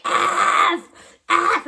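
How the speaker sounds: imitating a scream. Stressed commuter imitated screaming at the stopped train. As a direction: mimic the frantic man yelling. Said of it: "he would scream the F word".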